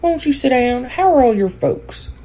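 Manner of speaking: high, brisk hostess chatter; lightly voiced Marilla, briskly polite. A narrator's reading. Marilla welcomes Rachel in, brisk and high. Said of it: "Marilla said briskly".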